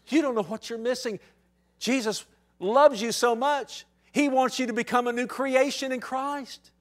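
high, loud, driving sermon voice; fiery pulpit preaching; preaching at full force. Acted. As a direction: preach it out with urgency. Preaching to congregation.

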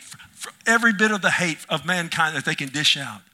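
loud, high climax of the sermon; impassioned preacher's climax; preaching at full force. Acted. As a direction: build and pound the word hate.

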